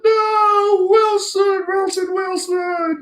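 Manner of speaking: hammed-up desperate calling after a lost friend; mock Castaway wail. Imitating Tom Hanks calling to his ball, high wail.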